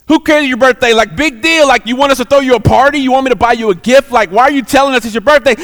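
dismissive mocking. Friends brush off a woman's birthday news, scornfully dismissive. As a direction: throw it back at her, who cares.

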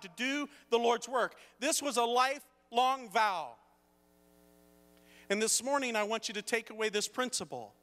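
high, forceful pulpit delivery; emphatic preaching. Acted. Preacher giving the morning's takeaway, emphatic preaching. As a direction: drive the principle home to the congregation.

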